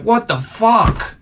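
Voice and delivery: high, indignant mock outrage from the womb; shocked baby voice; baby's outrage. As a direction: play the unborn kid fed up and squeaking. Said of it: "He's like".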